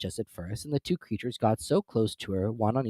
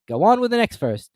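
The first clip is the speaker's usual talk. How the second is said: high, loud, bossy creature; a loud, high Griffin command; Griffin order; 11 semitones higher, 8 dB louder than usual. A narrator's reading. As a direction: push her on.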